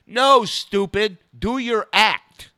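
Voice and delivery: slow, loud, high exasperated impression; Dice. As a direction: imitate him scolding, loud and drawn out. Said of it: "And he's going".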